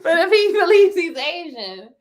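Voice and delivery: real laughter breaking into the question; laughing. Real, not acted. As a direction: asks it while cracking up.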